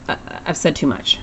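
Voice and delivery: soft unvoiced whisper; mock-conspiratorial whisper to the class; whispered aside. Real, not acted. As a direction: let it slip out as a secret aside.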